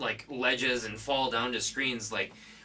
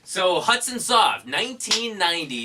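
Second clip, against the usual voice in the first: announcer reveal; 7 semitones higher, 8 dB louder than usual. Acted. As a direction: boom it out like a movie trailer.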